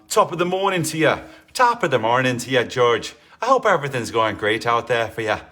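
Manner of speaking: loud, hammed-up Irish greeting; bad Irish accent. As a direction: do a cheerfully terrible Irish accent. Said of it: "Is this the worst Irish accent".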